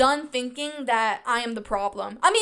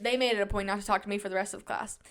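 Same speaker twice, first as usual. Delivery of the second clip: usual speaking voice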